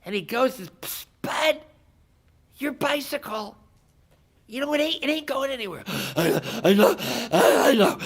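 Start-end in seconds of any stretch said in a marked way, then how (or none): 0.8-5.8 high-pitched, confidential aside; furtive alert. Acted. Alien to guy on bicycle, high furtive alert. 5.8-8.0 gasping, mock-exhausted words; out of breath. Cyclist answers the alien, acting badly winded. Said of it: "He can't breathe".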